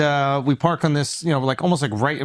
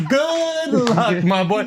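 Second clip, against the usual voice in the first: mock meter maid; 7 semitones higher, much slower than usual. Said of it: "He goes".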